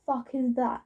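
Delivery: mock-baffled quote of oneself; acted bafflement. Vlogger re-enacting their reaction to furries, mock-baffled. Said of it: "and me being like".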